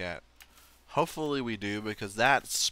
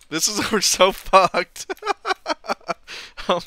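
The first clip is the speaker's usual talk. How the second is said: laughing; 9 semitones higher, 11 dB louder, slower than usual. Real, not acted. Laughing at the disaster.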